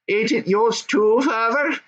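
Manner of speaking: put-on high young goblin son. Goblin son answering his dad, high and eager.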